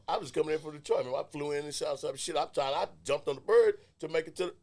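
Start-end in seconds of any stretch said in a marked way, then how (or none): none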